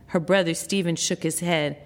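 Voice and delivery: ordinary delivery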